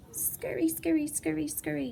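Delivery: lightly voiced tiny spider, high and hushed; little spider. A hint of a little spider scurrying away. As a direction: scuttle the words off like a small spider. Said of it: "says a little spider".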